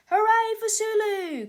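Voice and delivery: high loud cheer; cheering cry. A narrator's reading. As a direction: shout hooray for the hero. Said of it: "cried Harold".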